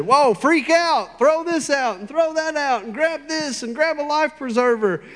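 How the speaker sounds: high, loud mock panic; freaking out. Anybody else caught in a storm, acted panic. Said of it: "Anybody else would have said".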